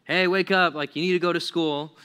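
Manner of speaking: parent's wake-up call. Acted. Parent rousing a kid for school, mild wake-up call. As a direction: call a sleepy kid out of bed.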